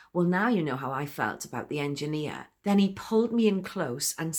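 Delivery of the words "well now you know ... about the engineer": ordinary delivery, nothing marked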